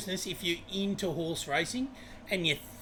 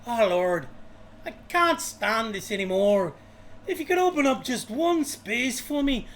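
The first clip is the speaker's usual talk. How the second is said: higher, louder Irish pleading prayer; an Irish joke voice rising into a louder plea to heaven; Irish accent; 6 semitones higher, 6 dB louder than usual. Retold or imitated. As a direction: beg the Lord in a hammed-up Irish accent. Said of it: "should I do the Irish accent?".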